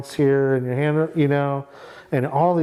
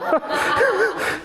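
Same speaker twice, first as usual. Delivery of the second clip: high burst of laughter. Real, not acted. Laughs at his own money line.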